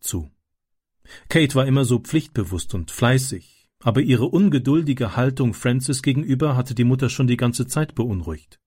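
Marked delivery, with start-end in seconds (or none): none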